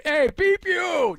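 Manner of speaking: high, slow New York shout back; New York accent yell. Retold or imitated. Crash victims yelling back, New York accent. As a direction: fire it back like an angry New Yorker. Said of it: "back at him in the New York accent".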